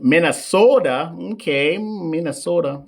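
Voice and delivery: hammed-up Minnesota accent with stretched vowels. Teasing a viewer from Minnesota, mock Minnesota accent. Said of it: "You guys say bag".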